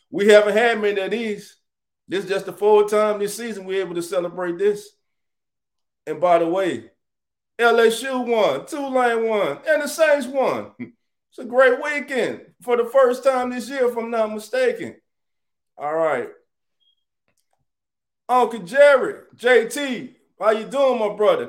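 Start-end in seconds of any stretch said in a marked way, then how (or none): 0.1-15.0 higher and louder, bursting with joy; excited celebration. Real, not acted. Host celebrating a win with his viewers, excited. Celebrating victory Sunday on stream. 18.3-21.5 high, loud shout-out; hype greeting. Real, not acted. Streamer welcoming Uncle Jerry into the chat, hyped.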